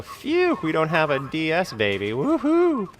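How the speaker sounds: high, mocking cheer of relief. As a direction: ham up a relieved whoop.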